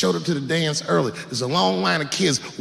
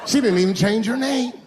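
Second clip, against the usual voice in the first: indignant; 6 semitones higher than usual. Acted. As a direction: say it outraged first. Comedic repeat.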